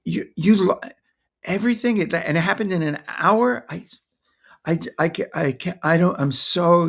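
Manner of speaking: high, halting, stammering burst of shared shock; mirroring their upset. Shown as a demonstration. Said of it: "So it's like".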